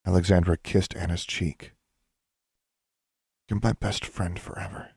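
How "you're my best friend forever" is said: tender farewell. Acted.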